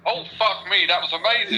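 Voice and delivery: mock excited swearing. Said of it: "What sounds more genuine".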